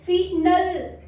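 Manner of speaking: फिनल as fin-ul, short i; wrong short I. Shown as a demonstration. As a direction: misread final as फिनल, fin plus ul. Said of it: "फिनल नहीं होगा". (फिनल, छोटी 'इ' से गलत)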